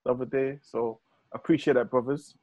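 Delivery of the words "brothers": normal talking voice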